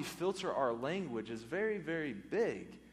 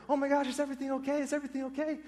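much higher, fast, alarmed cry of a worried wife; panicked wife; 12 semitones higher, 5 dB louder, much faster than usual. Retold or imitated. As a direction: rush in frightened by the crash. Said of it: "Hannah comes running out the door".

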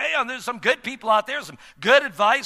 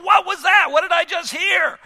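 surprised; 10 semitones higher, 7 dB louder than usual. Retold or imitated. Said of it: "you go".

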